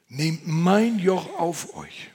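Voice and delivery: proclaimed words of Jesus; solemn quote. Acted. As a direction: lay weight on "mein", slowly. Quoting Jesus, slow and loud.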